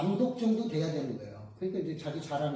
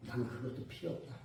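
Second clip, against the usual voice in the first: soft, low refusal; quoted Jesus; 7 semitones lower, 11 dB quieter than usual. Retold or imitated. Jesus turning down the director's offer, quiet and low. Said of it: "예수님께서 그러시래요".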